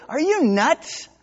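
high, slow New Jersey disbelief; a blunt New Jersey "are you nuts". Retold or imitated. New Jersey angel scolding the doubter, incredulous. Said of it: "being from New Jersey, I would say".

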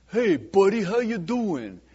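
a fake-friendly racketeer sizing up a shop; mob guy. Mobster greets dry cleaner.

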